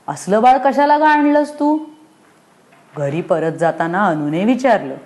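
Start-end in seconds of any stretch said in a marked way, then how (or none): 0.1-1.8 put-on higher, outraged little girl; indignant child. Anu scolds her mother about the baby, higher. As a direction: demand it like a cross child.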